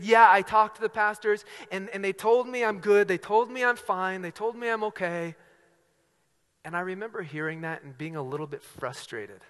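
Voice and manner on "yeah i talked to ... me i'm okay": loud, raised, acted protest; pressing, exasperated retelling; agitated insistence. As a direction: imitate a worked-up camper listing reassurances. Acting camper's agitated insistence.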